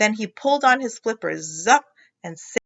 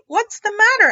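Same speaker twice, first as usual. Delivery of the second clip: worried mother; 13 semitones higher, 8 dB louder than usual. Acted.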